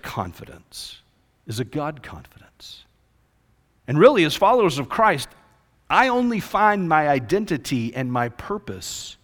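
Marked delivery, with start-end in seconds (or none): 0.0-2.8 voiceless, hushed climax; dramatic whisper. Real, not acted. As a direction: whisper it like a secret. Preacher drops to a whisper.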